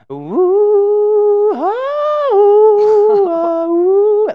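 very high, loud wailing ooh; moon howl. Retold or imitated. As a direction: wail up at the moon. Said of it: "looking at the moon, like".

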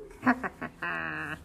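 low playful laugh. A narrator's reading. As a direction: chuckle after the surprise. Laugh after the boo.

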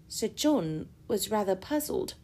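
plain reading voice

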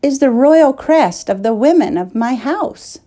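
high, amazed realization; astonished princess over the embroidered cloth. A narrator's reading. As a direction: let the wonder lift the voice. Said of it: "she said with astonishment".

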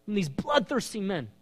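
high, pleading cry to God; David's cry. Acted. David begging God for rescue, high pleading cry. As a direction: lift the plea like a desperate prayer. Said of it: "cry that's coming out of his heart".

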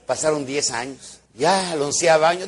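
ordinary delivery

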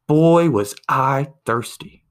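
slow, loud, satisfied sigh of a line; drawn-out loud punchline. Retold or imitated. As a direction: stretch it out like the last gulp of relief. Punchline, man finally drank.

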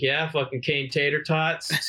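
slow, high mock "Kane Tater Tots"; mocking mispronunciation. Teasing drunk buddy with a silly name, slow mock drawl. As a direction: stretch "Tater Tots" to tease him. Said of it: "I was like".

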